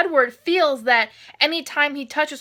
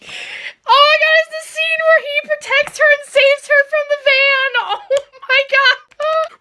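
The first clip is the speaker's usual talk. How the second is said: high, loud, thrilled squeal; giddy excitement; 12 semitones higher, 6 dB louder than usual. Real, not acted. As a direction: squeal over your favourite scene. Thrilled by the van scene.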